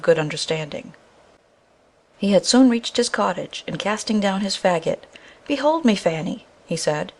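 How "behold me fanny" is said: excited. A narrator's reading. Woodcutter rushes home with news.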